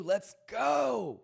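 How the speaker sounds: high, loud rallying cheer; hyped shout. Real, not acted. Cheering a viewer who is working here too, hyped shout. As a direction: shout it out, stretching the GO.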